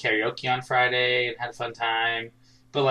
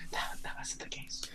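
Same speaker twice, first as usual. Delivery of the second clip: playful whisper; 19 dB quieter than usual. Pretend. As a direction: whisper it like a secret. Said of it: "We gotta whisper it now".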